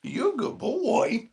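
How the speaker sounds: high, slow praise for the dog; dog talk. Real, not acted. Talking to his dog.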